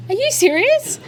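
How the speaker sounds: high, incredulous yelp; shocked disbelief. Retold or imitated. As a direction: act out your own stunned reply. Retelling her shocked reaction.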